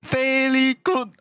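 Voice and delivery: high, slow put-on Chinese accent; mock Chinese accent. Friends doing broken English, slow mock Chinese accent. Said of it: "we're doing the Chinese accent".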